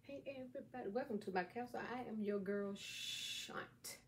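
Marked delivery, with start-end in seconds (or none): 2.8-4.0 Shant with a long, hushed "sh" into "ant"; name pronunciation. Shown as a demonstration. Host introducing herself to viewers, name pronunciation with Shant's "sh" drawn out. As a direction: draw out the "sh" opening of Shant. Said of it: "That's the way you say it".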